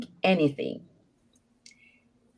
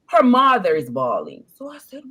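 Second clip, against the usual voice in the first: ordinary talk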